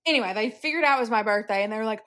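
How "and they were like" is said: ordinary talk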